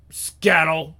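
ordinary talk, nothing marked

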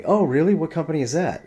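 high, loud, nosy imitation; mock eager interest. Imitating the woman prying into where he works, overeager. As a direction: pounce on the news with exaggerated curiosity.

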